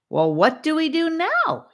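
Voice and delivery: high, loud impatient demand. Acted. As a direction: insist on an answer now. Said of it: "he demanded".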